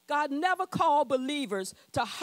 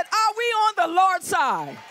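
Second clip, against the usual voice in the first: louder shouted call to the crowd; full preaching shout; 4 semitones higher, 6 dB louder than usual. Real, not acted. Preacher shouts again so the church answers louder. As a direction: lift the room with a loud call. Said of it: "Can we just sound for a moment like we on the Lord's side".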